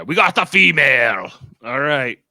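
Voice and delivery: loud, strange alien voice. Retold or imitated. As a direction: do the reptile alien's voice. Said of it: "Every time he does that voice".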